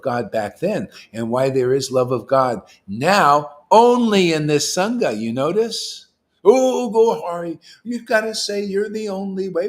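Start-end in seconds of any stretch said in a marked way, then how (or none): 6.4-9.7 high-pitched mocking objector; mock complaint. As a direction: imitate a complainer, pitched well up and loud at the start.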